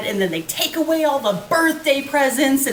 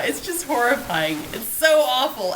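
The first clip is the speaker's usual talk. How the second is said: ordinary talk, nothing marked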